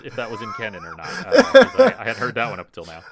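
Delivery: high, loud amused chatter; giggly fast disbelief. Real, not acted.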